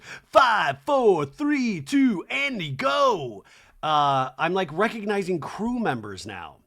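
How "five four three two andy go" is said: loud, high imitation of a floor cue; stage manager's countdown. Stage manager counting the host in, loud countdown. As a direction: call the count out across a studio. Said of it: "he's the guy that's like".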